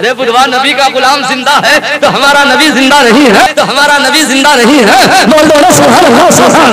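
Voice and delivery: loud, high rebuke to a doubter; preaching at full force. Acted. Preacher scolding the doubters before the crowd, loud and high. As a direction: hammer the point home.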